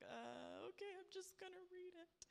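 high, soft little voice; bewildered resignation. Retold or imitated. Confused new reader opening the Bible, high-voiced resignation. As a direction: imitate yourself giving in, clueless.

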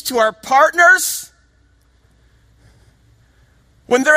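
high, loud, driving rhetorical questions; impassioned preaching. Real, not acted. Preacher challenging the congregation for persecuted believers, loud and high. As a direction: hammer each question home to the congregation.

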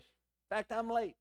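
normal talking voice

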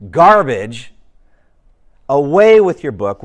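stubborn neighbor scorning the Bible; contemptuous. Acted. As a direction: throw the book away with your voice. Obstinate dismissing the book.

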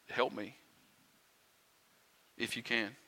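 soft, unsure plea for help; hesitant, doubtful plea. Acted. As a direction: ask quietly, as if doubting it will work. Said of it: "Can you hear the doubt in his words?".

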